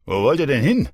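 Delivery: loud, high mocking taunt; jeering. Drunk boyfriend taunting the mother, loud and mocking. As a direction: taunt her, daring her to leave.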